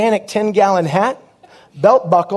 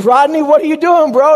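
surprised Gary; 7 semitones higher, 5 dB louder than usual. Retold or imitated. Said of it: "He goes".

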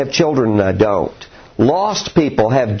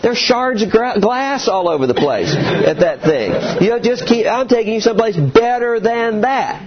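put-on much higher, strained father reasoning with kids; a dad fed up with backseat whining; exasperated dad; 10 semitones higher than usual. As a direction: plead with the boys while driving. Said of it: "And I'm like".

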